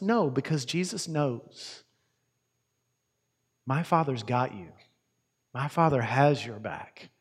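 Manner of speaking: tender promise that God protects them; reassuring. Real, not acted. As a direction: comfort the listeners quietly. Preacher reassures the congregation.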